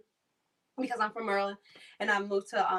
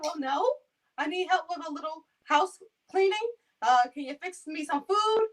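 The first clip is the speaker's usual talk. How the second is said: put-on higher old man voice, asking more; a lightly voiced old man listing needs; imitating him; 7 semitones higher than usual. Client asking caregiver for more help, lightly voiced. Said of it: "act like him".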